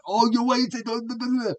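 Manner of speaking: high, sing-song mockery of complainers; mock the people who will twist his words, pitched high; parroting imagined critics in a high mocking voice; mocking whiny critics. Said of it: "People are gonna take this".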